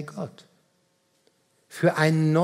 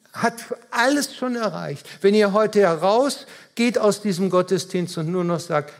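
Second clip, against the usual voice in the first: ordinary talk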